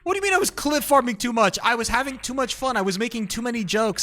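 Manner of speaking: indignant rant. Real, not acted. Streamer venting at chat over being called a clip farmer, indignant yelling. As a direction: blow up at the criticism in disbelief.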